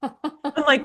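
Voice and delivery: ordinary talk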